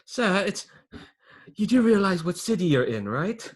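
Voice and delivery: high, pointed protest to a superior; nervous underling. Acted. Underling reminds the boss what city this is, nervously insistent. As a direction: reason with the boss, high and hesitant. Plays Phineas answering the boss.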